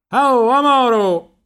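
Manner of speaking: very high, drawn-out yell; shouting. A narrator's reading. Riccetto hails the rower, very high long shout. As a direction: holler to get his attention. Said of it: "gli gridò il Riccetto".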